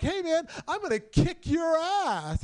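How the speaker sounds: retold threat. Angry shopper threatens the comic, mock menace. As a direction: play the stranger picking a fight.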